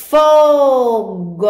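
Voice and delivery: FOGO stretched high, FOOO-GOOO; leitura silabada. Shown as a demonstration. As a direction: sound out FOGO slowly for young readers. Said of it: "Agora vamos para a palavrinha". (palavra esticada, em tom mais alto)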